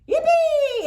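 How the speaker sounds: very high, loud cheer; yelled. Acted. Kids cheering about ice skating, loud high yell. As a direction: whoop with joy. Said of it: "everybody yelled".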